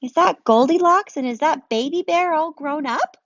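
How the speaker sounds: stunned by a reunion; amazed disbelief. Acted. Amazed question in story.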